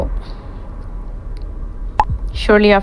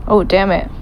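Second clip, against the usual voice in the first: usual speaking voice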